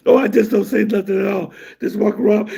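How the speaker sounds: usual speaking voice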